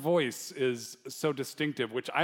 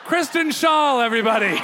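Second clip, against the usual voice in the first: loud, high stage announcement; rousing introduction; 11 semitones higher, 11 dB louder than usual. Real, not acted. Host presenting guest to an audience, rousing shout. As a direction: shout the guest's name to the crowd.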